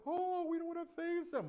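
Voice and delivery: high, loud whine of mock fear; hammed-up cowardly protest; scared soldiers. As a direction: wail like a reluctant coward. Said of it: "they're like".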